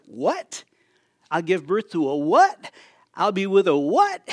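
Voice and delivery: rising, disbelieving questions; as Mary, stunned at the news of a son; incredulous what. Acted. Mary's incredulous reaction acted out.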